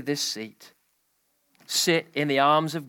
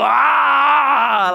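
loud roaring "Ah!" much higher and louder; imitated lion roar; 9 semitones higher, 8 dB louder than usual. Imitating the lion that roared at the camp, loud roar. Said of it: "There was this loud roar, like this".

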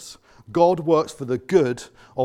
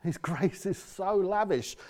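slow, soft wonder; quiet marvel at grace; awed refrain; 5 semitones higher, 7 dB quieter, slower than usual. Real, not acted. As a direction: hush into awe.

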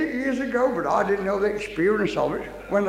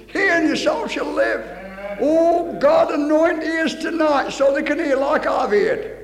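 raised, high preaching voice; fervent proclamation; 5 semitones higher, 5 dB louder, slower than usual. Real, not acted. Preacher prays for ears to hear, fervent proclamation. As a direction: call out verse and prayer fervently.

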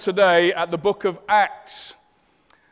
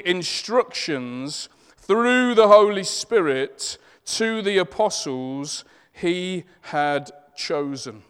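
scripture reading; 5 dB louder than usual. Acted. Reads scripture aloud.

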